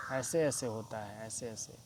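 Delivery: ordinary talk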